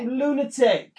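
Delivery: high loud demanding shout; yelling. Acted. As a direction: bellow for the rope. Yelling at fidgeting Dylan.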